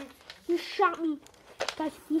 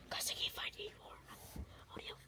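whisper; 16 dB quieter, much faster than usual. Real, not acted. Hiding in game, whispering.

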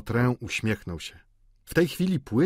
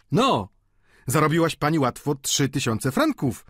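raised, higher exclamation; okrzyk Poireta; 6 semitones higher, 4 dB louder than usual. A narrator's reading. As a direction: call out with a touch of wonder. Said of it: "zawołał Poiret". (podniesionym, wyższym głosem)

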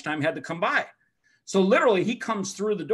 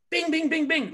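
rapid-fire dinging; 8 semitones higher than usual. Retold or imitated. Imitates answers coming quickly.

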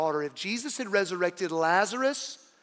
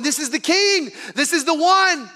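excited crowd; 7 semitones higher, 6 dB louder than usual. Retold or imitated. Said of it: "people are going".

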